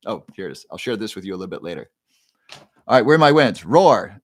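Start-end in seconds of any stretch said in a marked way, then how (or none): 2.5-4.2 louder, calling out to the whole chat; loud rallying call. Real, not acted. Host shouting to his livestream viewers for wins, loud rallying call.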